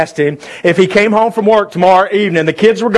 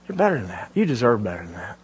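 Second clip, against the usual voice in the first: tender appeal; 7 semitones lower, 9 dB quieter, faster than usual. Real, not acted.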